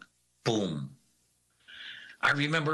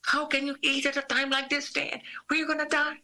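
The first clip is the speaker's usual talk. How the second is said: high, imitated panicked woman; supervisor's shrill imitated panic. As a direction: pitch way up, mock alarm. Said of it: "the lady's like".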